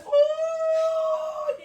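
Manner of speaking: long, very high-pitched "ooh"; delighted ooh. Real, not acted. Cook raving about potato salad, delighted high ooh. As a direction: swoon over food with a sustained high hoot.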